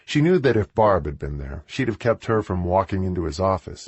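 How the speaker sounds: ordinary delivery, nothing marked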